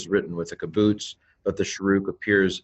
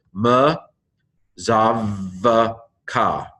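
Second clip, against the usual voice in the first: word broken into drawn-out syllables with pauses between; 2 semitones higher, 3 dB louder, much slower than usual. Shown as a demonstration. Teacher breaking a Hebrew word down for learners, syllable by syllable. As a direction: sound out "ma zav ka" piece by piece. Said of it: "the Hebrew pronunciation".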